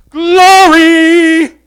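GLORY belted out high and very long; far greater glory. Shown as a demonstration. Preacher showing the greater glory, high booming drawn-out "glory". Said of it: "The degrees of glory".

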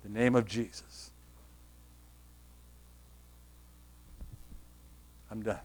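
low, soft questions with long pauses; subdued, low-pitched sermon delivery; hushed preaching. Acted. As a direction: quietly put searching questions to the congregation.

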